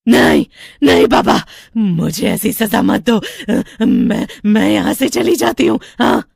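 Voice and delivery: loud frightened begging; pleading witch. A narrator's reading. Witch begging the sage not to punish her. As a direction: beg for mercy in panic.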